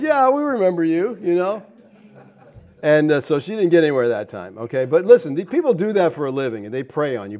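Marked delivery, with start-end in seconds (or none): 0.0-1.2 knowing reply. Retold or imitated. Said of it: "And I'm like".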